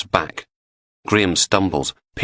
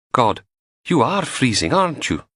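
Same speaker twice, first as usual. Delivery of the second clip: higher, teasing wife rising up; lightly voiced Samantha; 7 semitones higher than usual. A narrator's reading. Samantha teasing Logan about his cold body, lightly voiced. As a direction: tease him about the cold.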